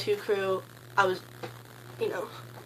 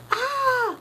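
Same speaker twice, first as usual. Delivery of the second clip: much higher fangirl squeal; excited squeal. Retold or imitated. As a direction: squeal like a starstruck fan. Surprised squeal at Grant's question.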